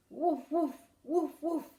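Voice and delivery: loud playful barking; mock woofs for the kids; dog woof. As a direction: bark like a happy dog. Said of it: "Can you make that sound?".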